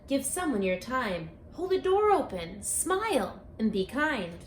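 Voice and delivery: plain reading voice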